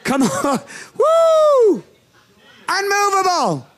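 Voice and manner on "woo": loud high woo; whooping laugh in church; excited whoop with laugh. Real, not acted. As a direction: let out a joyful whoop. Preacher's excited shout.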